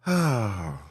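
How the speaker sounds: long falling sigh; real winding-down sigh. Real, not acted. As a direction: let the breath out after laughing. Winds down after laughing.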